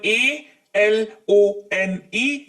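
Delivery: drawn-out spelling of एएलओएनई; each letter of एएलओएनई said apart; spelled out slowly. Shown as a demonstration. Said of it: "word spelling".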